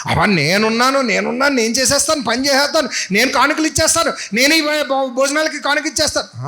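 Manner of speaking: high, pompous bragging voice; mock boastful hero. Mocking the eager disciple as a braggart. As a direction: puff up like a show-off. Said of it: "పెద్ద హీరోలాగా అన్నిటికీ ముందు వెళ్ళిపోతాడు".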